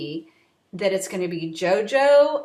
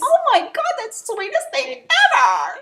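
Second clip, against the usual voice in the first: much higher, delighted coo; gushing squeal; 11 semitones higher than usual. Retold or imitated. Reacting to JoJo calling Ben babe, high gushing squeal. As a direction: swoon over how sweet it is. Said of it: "I'm like".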